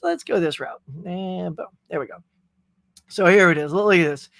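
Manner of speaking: usual speaking voice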